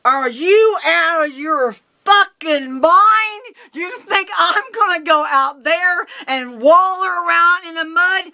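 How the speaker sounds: high, loud, drawn-out yell of outrage; explode, stretching the words high and loud; furious outburst. Retold or imitated. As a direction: blow up at him in incredulous rage. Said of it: "I just blew up".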